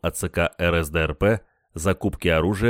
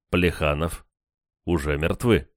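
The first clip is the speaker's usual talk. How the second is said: usual speaking voice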